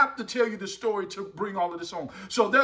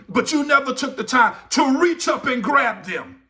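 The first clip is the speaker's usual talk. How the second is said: high, driving sermon delivery; impassioned preaching; 7 semitones higher, 6 dB louder than usual. Real, not acted. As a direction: press it on the congregation with rising urgency. Preaches at full force.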